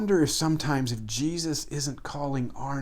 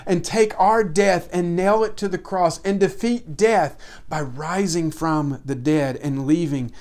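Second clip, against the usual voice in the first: raised, louder preaching, building phrase by phrase; fervent pulpit delivery, pushing higher and harder; preaching at full force; 4 semitones higher, 4 dB louder than usual. Acted. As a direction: preach it out, pressing each line home. Preaching climax on remembering Jesus.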